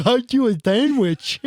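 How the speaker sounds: high, slurred mock echo; deaf guy voice. Shown as a demonstration. Joining in, parroting the sandwich line in deaf guy voice. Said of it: "I love deaf guy voice".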